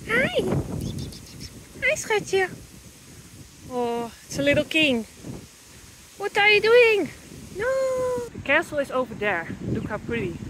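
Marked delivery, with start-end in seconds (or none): 0.1-2.5 high cooing voice to a cat. Real, not acted. 6.2-8.3 pet talk. Real, not acted. Talking to the cat.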